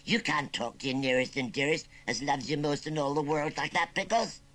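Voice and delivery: understated rustic menace; menacing. Acted. Uncle scolding his rude nephew, mildly menacing. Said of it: "just as menacingly".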